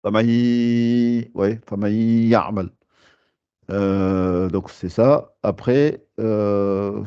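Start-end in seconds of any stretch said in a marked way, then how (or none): 0.0-1.3 famay said slowly, nun dropped, ma running into a doubled ya through the nose; nasal merging demo. Shown as a demonstration. Teacher showing idgham to students, slow nasal demo. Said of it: "je sors le son par le nez". 1.7-2.7 famay ya'mal said slowly, the nun dropped and the sound sent through the nose into ya; nasal merging demo. Shown as a demonstration. Teacher reading the example aloud, slow nasal merge. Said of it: "je sors le son par le nez".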